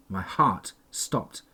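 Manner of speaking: ordinary delivery, nothing marked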